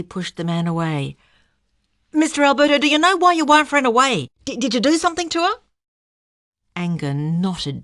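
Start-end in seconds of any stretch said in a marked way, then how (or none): 2.1-5.6 loud, high, fast accusing; aggressive reporter. A narrator's reading. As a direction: hammer him with questions.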